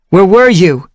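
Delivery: high, loud scolding question; worried question. Acted. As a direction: demand where she went. Whitewing questioning.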